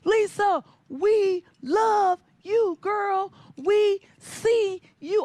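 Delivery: high, loud cheering from the stands; proud relatives whooping from the bleachers; family cheering. Retold or imitated. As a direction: yell it across the pool to her.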